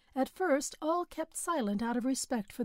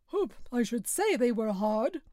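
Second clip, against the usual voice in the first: rather virile voice; 3 dB louder than usual. A narrator's reading. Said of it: "rather virile voice".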